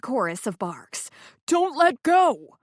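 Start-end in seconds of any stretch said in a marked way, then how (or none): 1.5-2.6 high, loud, slow plea; dangling man crying up in terror; frightened cry. Acted. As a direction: beg them not to drop you. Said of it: "Zane cried".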